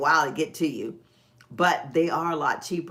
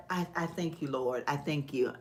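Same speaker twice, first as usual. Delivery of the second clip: soft prayer; 2 semitones lower, 7 dB quieter than usual. Retold or imitated. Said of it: "I tell God".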